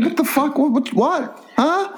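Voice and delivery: high, baffled squawk of confusion; baffled outburst. Retold or imitated. Retelling how he strained at the video, baffled and high. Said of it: "and I'm going".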